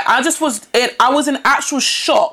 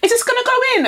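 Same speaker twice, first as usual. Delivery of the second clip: much higher, mock-innocent question; imitating the woman; 13 semitones higher, faster than usual. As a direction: mimic her clueless ask, pitched way up. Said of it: "Then she goes".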